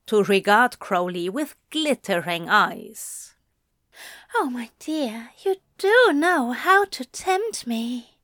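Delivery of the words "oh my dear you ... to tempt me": higher, breathy tempted angel. Acted. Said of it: "he breathed".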